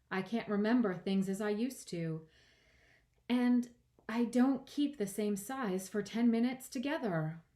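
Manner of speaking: lightly voiced girl, rising in pitch and force; understated worried girl character; earnest young Alice. A narrator's reading. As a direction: explain with growing emphasis.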